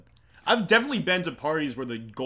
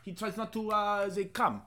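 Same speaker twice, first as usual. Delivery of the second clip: put-on German accent; 6 semitones higher than usual. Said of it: "You have to say it in a German accent".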